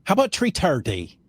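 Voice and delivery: imitated Irish, "three thirty" as "tree tirty"; thick Irish accent. Vendor offers another meeting time, imitated Irish accent. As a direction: play the Irish caller, every TH a hard T. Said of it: "thick Irish accent".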